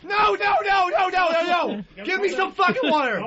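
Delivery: high yelling broken by laughs; frantic yelling. Acted. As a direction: scream in panic, half laughing. Burning, begging for water.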